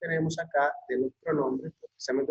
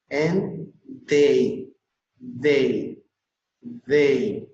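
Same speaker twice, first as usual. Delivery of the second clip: THEY louder, with a falling tune; pronunciation drill; 4 dB louder, much slower than usual. Shown as a demonstration. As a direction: punch out THEY and let it fall. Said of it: "pues vuelvo a repetir".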